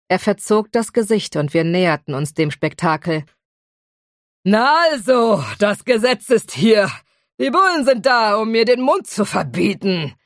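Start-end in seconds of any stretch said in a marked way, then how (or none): 4.4-10.1 put-on snorting drunken dragon in a broad Liverpool accent; a tipsy dragon snorting in broad Liverpool dialect. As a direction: scoff at the police in thick Liverpool Scouse. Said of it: "schnaubte der Drache mit einem breiten Liverpooler Akzent".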